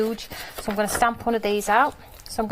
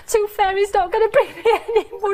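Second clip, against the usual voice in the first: put-on higher, childlike lament; imitating her little son; 11 semitones higher than usual. Mum voicing her son who fears no tooth money. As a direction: play the disappointed little boy.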